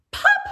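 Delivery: high sharp pop; popping sound. Acted. Reader imitating a button popping, sharp pop.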